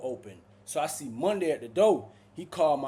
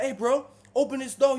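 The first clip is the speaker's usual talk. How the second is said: loud, high plea through door; yelling; 10 semitones higher, 8 dB louder than usual. Retold or imitated. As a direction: shout to be let out. Yelling at officer.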